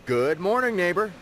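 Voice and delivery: bright, upbeat morning greeting; cheerful. A narrator's reading. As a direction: say it cheerfully to the neighbor. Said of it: "said Kyle cheerfully".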